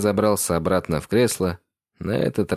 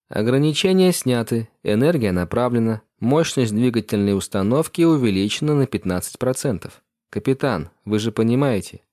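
higher, even computer assistant; ship AI voice; 4 semitones higher than usual. Acted. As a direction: report status like a ship computer. Бортовой ИИ Ватсон отвечает. (голос бортового компьютера)

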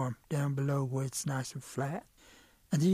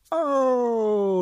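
pained cry; 7 semitones higher, 9 dB louder than usual. Acted. Rabbit bitten by snake.

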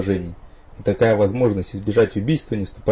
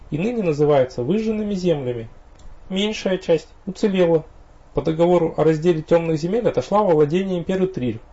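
higher female character voice; young woman voice; 8 semitones higher than usual. A narrator's reading. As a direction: explain it like the vampire girl. Viriena explains in her voice.

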